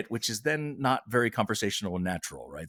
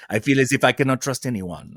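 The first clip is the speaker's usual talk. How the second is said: put-on louder, emphatic French Canadian accent; 3 semitones higher, 7 dB louder than usual. Repeating the actress's confession, emphatic French Canadian accent. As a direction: declare it firmly with French Canadian vowels. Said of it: "in her French Canadian accent".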